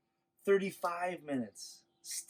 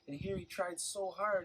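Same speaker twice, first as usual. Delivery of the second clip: usual speaking voice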